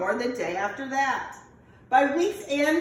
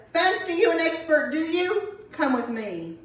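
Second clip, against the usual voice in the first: a touch louder, higher scoff; mocking leprechaun; 6 semitones higher, 4 dB louder than usual. Leprechaun scoffing at Finn, mocking and raised. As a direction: scoff at the know-it-all.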